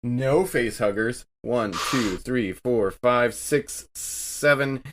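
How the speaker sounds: normal talking voice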